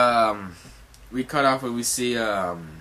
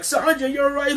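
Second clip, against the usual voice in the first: high, squeaky line; retelling an anime scene in a squeaky voice; high character voice. Retold or imitated. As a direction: pitch it way up into a cartoon voice. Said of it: "He's like".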